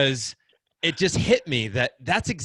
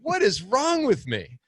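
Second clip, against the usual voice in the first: higher, louder, slow appalled cry; shocked at himself; 11 semitones higher, 6 dB louder than usual. Retold or imitated. Dad realizing he doubted his son, shocked. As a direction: throw up your hands at your own mistake.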